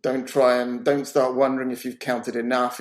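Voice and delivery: usual speaking voice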